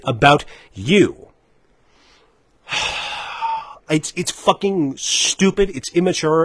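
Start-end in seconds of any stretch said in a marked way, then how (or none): 2.7-3.9 long, quiet exhale; exasperated sigh. Real, not acted. Ranter pausing amid anger at corporations, exasperated sigh. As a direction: let out a long weary breath.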